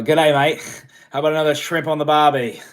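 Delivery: loud, hammed-up Aussie catchphrase; butchered Australian accent. Host teasing an Australian viewer, butchered Aussie accent. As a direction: do a badly faked Australian accent. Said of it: "I'm butchering an accent right now".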